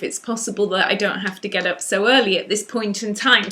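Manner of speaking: usual speaking voice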